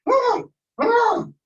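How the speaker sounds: high shrieking woo; excited squeal. Retold or imitated. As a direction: squeal like a thrilled kid. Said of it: "When my son sees spiders, he's like".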